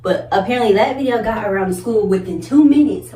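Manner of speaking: normal talking voice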